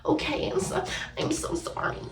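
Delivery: mock apology to the cheating boyfriend, sarcastic and airy.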